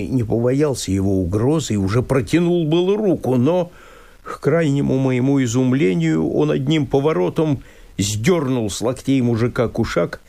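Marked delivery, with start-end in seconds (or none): none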